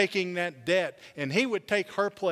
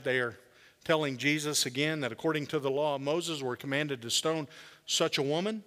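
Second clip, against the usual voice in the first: normal talking voice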